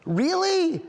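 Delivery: high-pitched stunned squeak of joy; excited disbelief. Retold or imitated. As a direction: reenact overjoyed shock, pitch shooting up. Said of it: "I was so excited".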